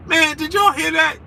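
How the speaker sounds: high, eager mock exclamation; excited exclamation. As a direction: blurt it out amazed. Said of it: "I was getting ready to be like".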